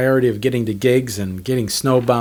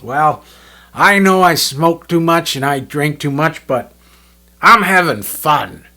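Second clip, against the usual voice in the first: louder, slower Bob, mock self-satisfied; carefree Bob; 4 semitones higher, 6 dB louder, slower than usual. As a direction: lean back and savour it like Bob. Bob's carefree line, retold.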